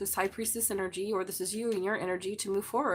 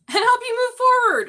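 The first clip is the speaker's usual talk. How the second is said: much higher, louder excited cry; excited exclaim; 11 dB louder than usual. Real, not acted. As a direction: burst out with delight. Excited reading out card.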